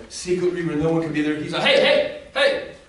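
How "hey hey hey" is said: calling out. Acted. Jesus calling out to woman.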